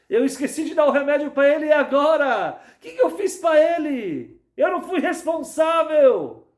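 high, loud panicked wail; desperate cry. Acted. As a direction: cry out in panic over the forgotten medicine. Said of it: "Esse monitor fica desesperado".

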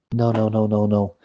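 ordinary delivery

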